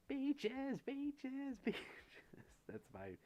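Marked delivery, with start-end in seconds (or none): 0.1-1.8 squealing falsetto, much higher and louder. Pretend. Streamer answering a viewer's request in a squealing falsetto. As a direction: belt the word beaches like a rock singer squeaking.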